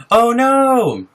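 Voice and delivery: high, loud laughing cry of oh no. Real, not acted. Streamer hearing a viewer watches via phone, laughing dismay. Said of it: "You're watching me on the phone".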